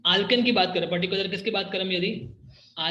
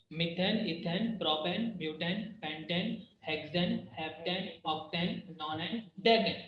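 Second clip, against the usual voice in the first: slow, softer, each name spelled out; slow careful recital; 8 dB quieter than usual. Shown as a demonstration. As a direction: list them slowly and evenly, a little softer. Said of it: "हम ये कोई टंग twister नहीं कर रहे". (धीरे और साफ़ एक-एक नाम)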